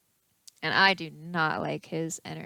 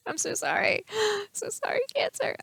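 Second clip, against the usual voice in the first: laughing; 14 semitones higher than usual. Real, not acted. Laughing apology after gum.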